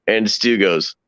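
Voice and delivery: usual speaking voice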